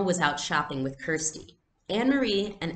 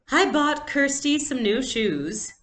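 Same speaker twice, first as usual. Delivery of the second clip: higher, slow, lightly voiced tired mom; a hint of a weary mother; 9 semitones higher, 5 dB louder, slower than usual. Mother to the girls, lightly exasperated. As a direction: sigh it out like a worn-out mother.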